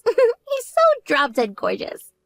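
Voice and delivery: high, slow, giggling gush; giggly swooning. Acted. Younger sister gushing to her sister about her boyfriend, giggling swoon. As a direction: swoon over him with a laugh.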